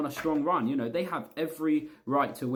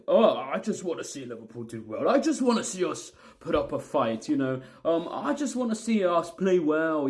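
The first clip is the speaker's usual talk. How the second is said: mocking moron-fan voice, raised a little; moronic fan; 4 semitones higher, 3 dB louder than usual. Fan imitating morons who just want a good showing, mocking. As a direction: mimic an easily satisfied fan with mild scorn. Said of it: "maybe the morons, you know, that think".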